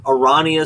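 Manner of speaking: Arania as a-RAH-nia; ah pronunciation. Shown as a demonstration. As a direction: say Arania with an open "ah". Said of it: "which one I actually prefer".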